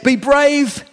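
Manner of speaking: high, loud call of comfort over the waves; reassuring call, high and loud; Jesus calling out. A narrator's reading. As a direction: call out to reassure terrified disciples. Jesus voiced in story.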